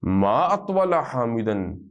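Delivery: ما اطول حامدن very slowly, weight on Attaul; slow model reading. Shown as a demonstration. Teacher showing students how to say it, very slow. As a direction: drag each word out so students can copy. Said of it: "تو کیسے کہیں گے؟".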